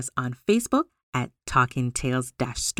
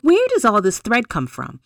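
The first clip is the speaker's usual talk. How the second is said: curious Tortoise; 4 semitones higher, 6 dB louder than usual. A narrator's reading. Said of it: "Tortoise asked".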